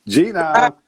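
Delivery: loud, high, drawn-out greeting; excited welcome shout; calling out a name. Real, not acted. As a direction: hail the guest with a big rising shout.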